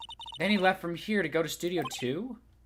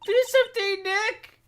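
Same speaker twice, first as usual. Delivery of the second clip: put-on pushy excited girl; 6 dB louder than usual. Voicing Maya urging fight.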